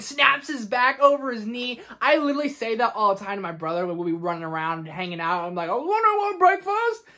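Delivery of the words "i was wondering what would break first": higher, louder, muffled menacing villain; Bane impression. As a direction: fan doing Bane's fight taunt. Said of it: "I'm sorry for all the Bane impressions".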